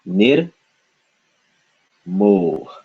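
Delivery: "Nir" rising, long pause, then falling "mo"; syllable by syllable. Shown as a demonstration. Teacher breaking up the word, "Nir, mo" syllable by syllable. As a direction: sound out "Nir" and "mo" separately. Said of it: "Second syllable".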